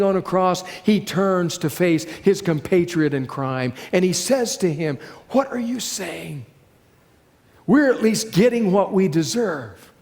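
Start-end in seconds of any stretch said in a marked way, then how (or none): 5.3-9.9 raspy voice. Acted. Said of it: "He too speaks through a raspy voice".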